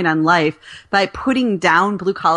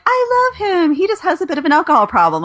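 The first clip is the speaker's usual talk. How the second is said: much higher, fast pleading client voice; high and breezy, waving off the problem; mock client excuse; 7 semitones higher than usual. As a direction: play a lovestruck client making excuses. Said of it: "if somebody says to me".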